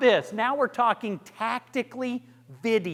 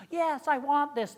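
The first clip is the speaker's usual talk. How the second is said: higher pleading plea; looking up, pleading; 7 semitones higher than usual. Shown as a demonstration. Coach shows the wrong, upward-looking plea. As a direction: beg like you are looking up at them. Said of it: "looking up to them".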